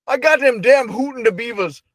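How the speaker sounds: grandpa impression. Friend joining the grandpa bit, high old-man twang.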